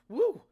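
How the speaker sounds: short, high, loud woo; startled whoop. Retold or imitated. Podcaster reacting to eight-dollar eggs, startled whoop. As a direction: whoop at a shocking price.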